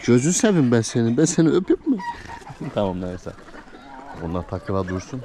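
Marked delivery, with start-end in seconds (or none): 0.1-2.0 doting coo. Real, not acted. Doting on goat. 2.0-2.7 squeaky giggle. Real, not acted. Laugh while doting.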